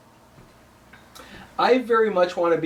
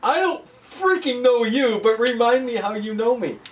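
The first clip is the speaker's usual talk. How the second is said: mock arrogant; 6 semitones higher than usual. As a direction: sound full of yourself. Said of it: "your way of saying".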